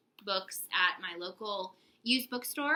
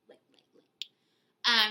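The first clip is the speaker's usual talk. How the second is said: put-on Valley Girl voice; 7 dB louder, much slower than usual. Shown as a demonstration. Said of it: "like a Valley Girl".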